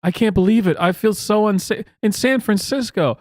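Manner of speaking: lightly voiced scared woman, mocked; mocking the woman.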